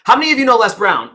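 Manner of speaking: fast, bright crowd-pumping question; energised. Shown as a demonstration. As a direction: fire up the room. Speaker demos audience opener, energised.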